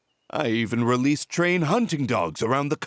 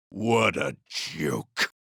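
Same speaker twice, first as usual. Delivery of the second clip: bitter scoff; 9 semitones lower, much slower than usual. Acted. Villain bitter over wasted decades.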